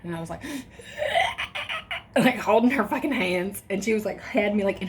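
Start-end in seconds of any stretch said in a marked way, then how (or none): 0.5-2.1 high, breathless gasp through a contraction; sharp gasp in pain. Retold or imitated. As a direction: gasp as if something is possessing you. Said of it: "I acted like the fucking exorcist".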